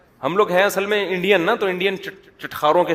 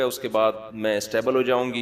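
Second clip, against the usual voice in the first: ordinary talk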